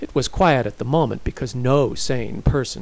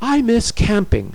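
high, loud lament; plaintive and raised; wistful complaint; 11 semitones higher, 6 dB louder than usual. A narrator's reading. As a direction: pipe up with a longing complaint. Heroine voices a wish.

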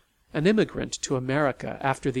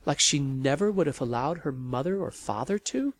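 usual speaking voice

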